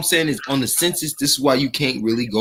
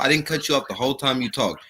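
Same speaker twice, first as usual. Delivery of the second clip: ordinary talk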